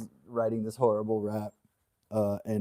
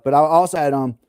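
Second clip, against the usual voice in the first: ordinary talk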